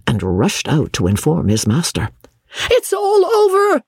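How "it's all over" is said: high panicked shriek of a frightened servant. A narrator's reading. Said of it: "he shrieked".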